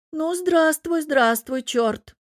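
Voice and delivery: a mocking elder greeting his foe; old man voice. Old huntsman greets the devil.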